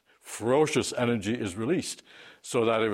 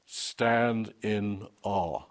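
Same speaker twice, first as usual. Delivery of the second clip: very slow, each word stretched, "Stand" weighted; emphatic scripture; much slower than usual. Shown as a demonstration. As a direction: let it land slowly with weight. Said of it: "it is emphatic".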